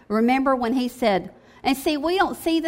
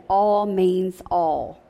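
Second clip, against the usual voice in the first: put-on quoting old pastor; 3 semitones lower, much slower than usual. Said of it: "my old pastor used to say".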